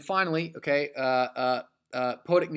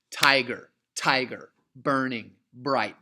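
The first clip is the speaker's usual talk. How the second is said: slow, separate falling beats on "tiger" and "burning"; aggressive trochaic stress; 5 dB louder, slower than usual. Shown as a demonstration. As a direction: punch the first syllable, "TIger, TIger, BURNing, BRIGHT". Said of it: "much more aggressive".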